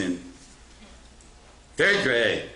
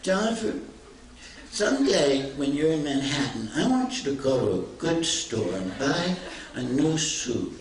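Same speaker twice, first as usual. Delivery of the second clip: put-on earnest little girl giving advice, pitched high; mock-serious schoolgirl advice; little guidance counselor; 6 semitones higher than usual. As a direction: lecture him kindly, like a child counselor. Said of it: "like a little guidance counselor".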